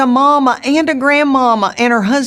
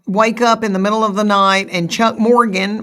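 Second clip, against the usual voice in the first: ordinary talk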